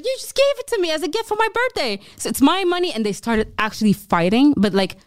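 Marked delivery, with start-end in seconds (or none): 0.1-2.9 much higher, whiny imitation of the birthday friend; mock indignant drunk. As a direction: squeak out the other guy's protest. Other friend argues back.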